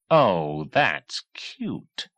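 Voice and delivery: mocking repeat of cute; dry sneer; sarcastic jab. A narrator's reading. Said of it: "And Murdoch repeated".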